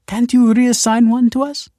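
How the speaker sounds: high, loud, pleading question; desperate plea. A narrator's reading. Desperate woman begging Kelsey for a child, pleading.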